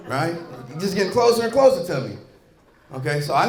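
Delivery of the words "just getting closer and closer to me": ordinary delivery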